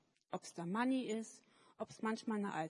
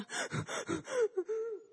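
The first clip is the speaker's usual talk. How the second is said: high, breathless sob-gasp; a little boy's tearful gasp; choked gasp; 11 semitones higher than usual. Acted. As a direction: gasp as if too upset to speak. Manni speechless, crying.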